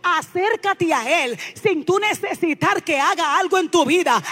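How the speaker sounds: loud, building exhortation; fervent preaching. Acted. Preacher urging the congregation toward God, building fervent preaching.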